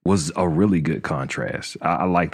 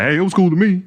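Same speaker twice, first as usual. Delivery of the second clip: high, louder breezy put-on voice; casual shrug; 13 semitones higher, 5 dB louder, faster than usual. Mocking his usual casual-watcher verdict, high breezy voice. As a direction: toss it off like a careless viewer. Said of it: "I'd just be like".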